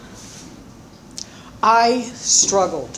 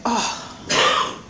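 whispered oh; 2 semitones higher than usual. Retold or imitated. Realising abandonment.

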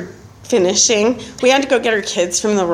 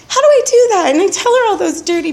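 high, louder pleading question; retold protest, pitched way up; acted-out dismay; 9 semitones higher, 5 dB louder, faster than usual. Acts out her dread of confessing.

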